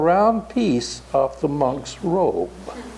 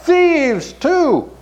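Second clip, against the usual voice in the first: shouting; 9 semitones higher than usual. Acted. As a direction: shout back to scare the robbers off. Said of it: "And Even shouted out".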